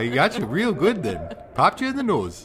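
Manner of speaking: put-on Minnesotan accent. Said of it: "What are we doing with Minnesotan accent".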